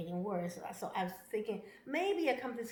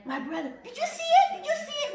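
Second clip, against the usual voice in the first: much higher and louder, thrilled; excited message to the daughter; 12 dB louder, faster than usual. Retold or imitated. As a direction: squeal the news to family.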